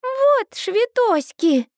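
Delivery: put-on high, slow little girl insisting on "швитоськи"; a tiny child putting her foot down; stern toddler voice. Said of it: "Минни грозно сдвигает брови".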